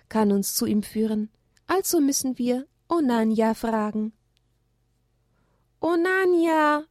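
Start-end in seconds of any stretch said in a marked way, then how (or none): 5.8-6.9 high, slow pleading call; pleading children in unison. A narrator's reading. Children beg the owl to guide them, pleading. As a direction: beg the owl together, drawn out. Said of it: "flehten alle drei im gleichen Atemzug".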